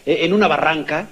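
usual speaking voice

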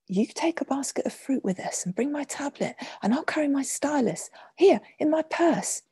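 put-on high, eager young girl's voice; excited exclaiming. A narrator's reading. Young mistress giving Hannah things to bring, excited exclaiming. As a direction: exclaim it brightly, pitched well up. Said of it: "Excitedly, she exclaimed".

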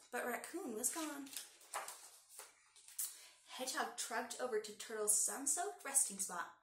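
ordinary delivery

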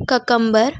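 ककम्बर as ka-KUM-bar; common mispronunciation. Shown as a demonstration. Teacher quoting what people say, mispronounced. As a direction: say ककम्बर with no y after the first k. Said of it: "लोगों से सुना है".

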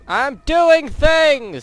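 high, loud, slow boast; mock proud student. Mocking a high schooler who thinks he's busy, loud and slow. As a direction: ham up a proud student's cheer. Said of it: "says every high school student".

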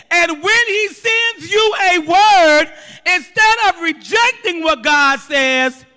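loud, high, emphatic sermon delivery; forceful preacher, slowing and stretching the key words; full-force preaching. Real, not acted. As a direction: drive each phrase home to the congregation.

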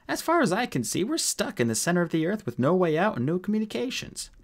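high-pitched exasperated rant; exasperated scolding. A narrator's reading. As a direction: lay out the grim facts, fed up. Holly scolds Mulch while stranded.

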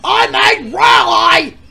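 mocking retort. Character retorting.

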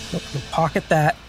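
ordinary delivery

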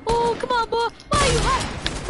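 hyped gamer shouting at the screen. Real, not acted. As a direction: squeal it out mid-fight. Gamer reacting hyped mid-fight.

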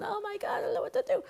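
high, panicky mock worry; anxious Vata. Anxious Vata panicking in the car, high mock worry. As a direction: flutter up high like a nervous Vata. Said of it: "like the Vata person going".

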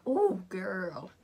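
loud, admiring exclamation; impressed viewer. Acted. Viewer seeing the vlogger's outfit, loudly impressed. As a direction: burst out with loud approval. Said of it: "you'll be like".

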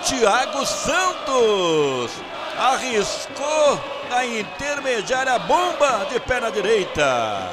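long, slow, roaring goal cry; goal call. Real, not acted. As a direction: stretch the goal shout out. Second goal, long shouted call.

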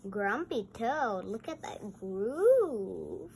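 normal talking voice

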